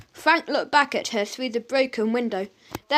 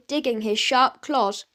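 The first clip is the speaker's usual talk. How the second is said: ordinary delivery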